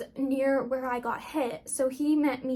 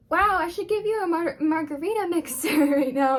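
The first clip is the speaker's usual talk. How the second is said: higher and louder, laughing through the joke; laughing paramedic joke; 4 semitones higher, 6 dB louder than usual. Retold or imitated. Paramedic joking in ambulance.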